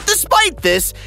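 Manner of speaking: ordinary talk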